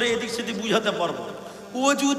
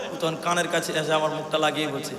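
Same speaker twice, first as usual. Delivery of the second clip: ordinary delivery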